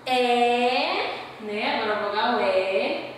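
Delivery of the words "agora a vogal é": normal talking voice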